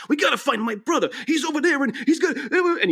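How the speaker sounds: high frantic cartoon impression; frantic Captain Lou. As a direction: squeal it like a frantic cartoon plumber. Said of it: "Captain Lou is just like".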